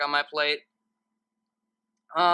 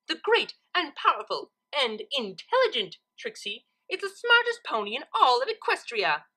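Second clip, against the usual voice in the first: high, boastful proclamation; gloating Trixie; 8 semitones higher, 4 dB louder than usual. A narrator's reading. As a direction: crow about her own genius. Trixie gloating, dancing around.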